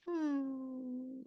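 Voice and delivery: high, soft whining hum; dog whine. Retold or imitated. Explaining older dogs' new whining, a soft high whine. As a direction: whine through the nose like a bored dog. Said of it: "like this kind of thing".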